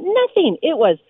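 ordinary talk, nothing marked

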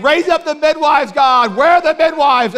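high, loud calling out; mock prophetic call. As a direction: cry out to God over the crowd.

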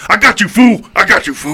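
loud cocky fighter shout; Mister T taunt. Retold or imitated. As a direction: bark the taunt at the rival.

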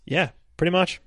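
high put-on shrug of a voice; Mark, casual. Mark agreeing with bearded man, high and offhand. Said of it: "And Mark's just like".

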